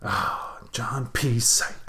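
soft, low whisper of dawning discovery; hushed mock realization. Co-host grasping the developer's pun name, mock-awed whisper. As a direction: whisper it as if the secret just clicked.

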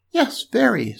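high piglet voice; agreeable little pig. A narrator's reading. Pig answers from tree.

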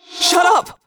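high, loud outburst; sharp high-pitched shout; screamed command. A narrator's reading. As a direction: scream to silence them. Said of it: "I screamed".

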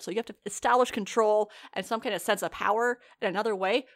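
usual speaking voice

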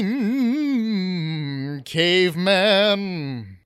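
very slow, stretched word; drawn-out caveman. Acted. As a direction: draw the word out slowly. Drawn-out persona title.

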